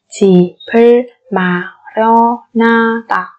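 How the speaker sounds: slow 집을 마련하다, each syllable on its own. Shown as a demonstration. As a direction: space out every syllable of 집을 마련하다. (đọc 집을 마련하다 chậm từng âm tiết)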